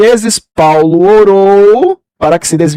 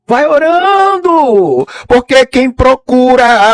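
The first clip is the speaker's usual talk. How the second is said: slow, stretched preaching call, higher; drawn-out exhortation; 5 semitones higher, much slower than usual. Acted. Preacher urging the church to keep praying, slow and drawn out. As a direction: stretch it out like a pulpit appeal.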